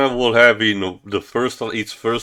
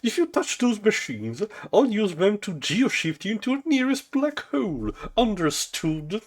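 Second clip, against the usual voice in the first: higher, softer, villainous threat; menacing threat; 11 semitones higher, 5 dB quieter than usual. Acted. Zog threatening his assistant, higher and softer. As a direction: hiss a dark warning.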